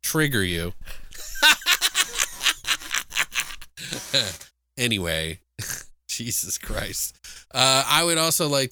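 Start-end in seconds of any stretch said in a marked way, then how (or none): 0.8-4.4 long high-pitched laugh. Real, not acted. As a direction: burst out laughing. Bursts laughing after mock apology.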